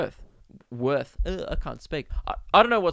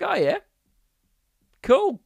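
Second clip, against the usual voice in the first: high fake-casual voice; 10 semitones higher, 4 dB louder than usual. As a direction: voice shooting up in mock interest. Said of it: "my voice went".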